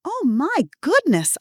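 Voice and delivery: put-on slow, high, drawn-out old-woman gasp; trembling old lady. Fake granny fooling a phone scammer, trembling and high. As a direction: play the frail, confused granny. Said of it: "making my voice tremble and switching to an elderly woman's tone".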